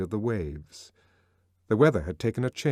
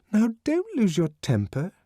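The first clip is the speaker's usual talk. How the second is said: higher, lightly chiding; Lenina soothing; 12 semitones higher, 4 dB louder than usual. A narrator's reading. Lenina calming Bernard, higher and chiding. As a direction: coax him gently, higher.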